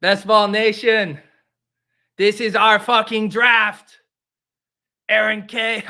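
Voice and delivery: put-on loud, high, slow rallying speech in a Dominican accent; attempted Dominican accent. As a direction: proclaim it to the crowd in a badly faked Dominican accent. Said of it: "I can't do a Dominican accent".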